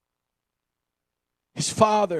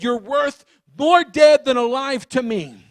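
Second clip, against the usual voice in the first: mock demand; 5 semitones higher, 6 dB louder than usual. Acted son's cruel demand.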